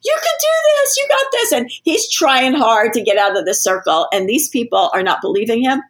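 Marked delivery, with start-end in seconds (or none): 0.0-1.5 very high, cheering encouragement, much higher; cheering crowd urging him to live. Retold or imitated. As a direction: squeal it like eager supporters. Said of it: "people on the outside screaming".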